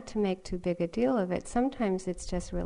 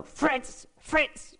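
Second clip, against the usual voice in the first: high calling out Fritz, louder at the end; priest calling the dog; 8 semitones higher, 4 dB louder than usual. Retold or imitated. Retelling a priest calling his dog, high calls. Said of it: "this man would go".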